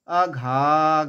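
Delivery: "আঘা" with "ঘা" held long. Shown as a demonstration. Teacher marking the long syllable, "ঘা" drawn out. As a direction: stretch the second syllable of "আঘা". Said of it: "ঘা দীর্ঘ".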